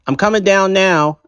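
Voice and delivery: high, called back down the stairs; calling out. Acted. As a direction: shout it down to Dad. Calling back downstairs to father.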